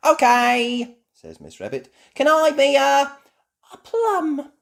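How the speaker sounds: put-on high, loud kids' voices; eager young chatter; little children. Children at the face painting stall, high and loud.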